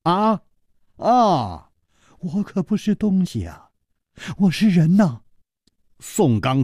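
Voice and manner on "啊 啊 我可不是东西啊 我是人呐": higher, playful, mocking reply; grinning banter. Zhang Xiaolin mocking the furious Song Gang, grinning. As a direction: tease back cheekily with a grin. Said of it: "笑嘻嘻地说".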